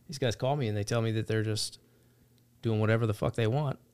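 normal talking voice

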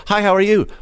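high, bright-pitched friendly hello; friendly greeting. Retold or imitated. Visitor greeting a Scot on the street, friendly. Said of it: "they're very friendly".

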